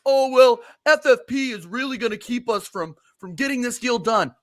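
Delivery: high, loud sneering parody of club excuses; mocking excuse. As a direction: mimic the excuse to ridicule it. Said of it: "I don't wanna hear any of this".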